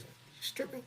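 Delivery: high, breathy stunned question; shocked question. Retold or imitated. Father learns his daughters strip, stunned whisper. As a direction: ask in stunned disbelief.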